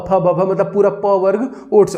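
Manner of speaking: usual speaking voice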